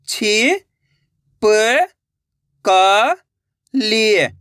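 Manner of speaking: छि, प, क, लिए drawn out with gaps; syllable by syllable. Shown as a demonstration. Teacher breaking a word for learners, syllable by syllable. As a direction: sound out छि, प, क, लिए singly. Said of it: "एक अक्षर का उच्चारण करके".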